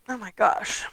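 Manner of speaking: said through a yawn; mid-yawn, mouth stretched. Real, not acted. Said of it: "I don't know why I'm yawning".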